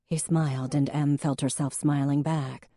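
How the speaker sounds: plain reading voice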